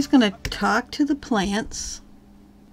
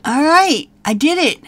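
much higher and louder cheering; excited cheer; 9 semitones higher, 12 dB louder, slower than usual. Real, not acted. Player catches the last bird, cheering loudly. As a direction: whoop with real triumph.

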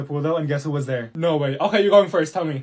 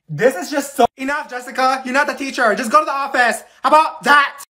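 raised, high teacher's voice; stern scolding; 6 semitones higher, 5 dB louder than usual. Acted. Teacher ordering Jessica to the office, loud scolding. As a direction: cut in and send the student out. Teacher cuts off student, sends her out.

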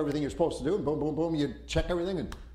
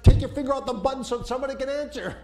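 loud, fast, high urgent order; urgent shout; 7 semitones higher, 6 dB louder, faster than usual. Retold or imitated. Man in back seat shouting at his friend, urgent.

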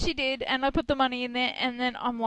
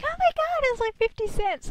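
mock-excited little girl, higher; excited child; 13 semitones higher than usual. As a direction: gush like a kid finding a coin under the pillow. Daughter voiced finding tooth money.

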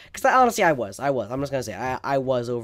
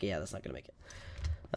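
resigned; 8 semitones lower, 13 dB quieter than usual. Retold or imitated. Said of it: "I'm like".

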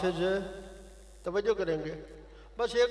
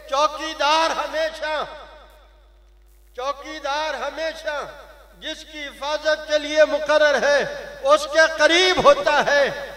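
loud, fiery sermon, pitch raised high; preaching at full force; 8 semitones higher, 12 dB louder than usual. Acted. Preacher scolding his listeners, full-force fiery sermon. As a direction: thunder it at the congregation like a rousing preacher.

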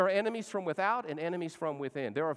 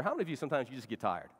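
usual speaking voice

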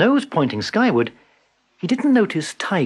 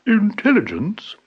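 higher, slow and grand; grandly; 8 semitones higher, slower than usual. A narrator's reading. Buffalo proudly correcting Tiger, grand and slow. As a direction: say it like a proud know-all. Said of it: "explained Buffalo grandly".

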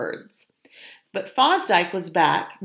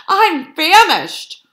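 usual speaking voice

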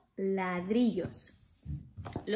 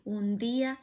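ordinary delivery, nothing marked

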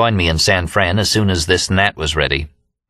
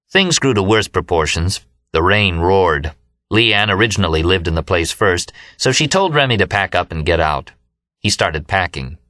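ordinary delivery

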